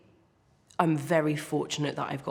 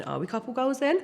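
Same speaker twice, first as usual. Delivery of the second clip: wry inner question; 10 semitones higher, faster than usual. Retold or imitated. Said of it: "And I'm thinking".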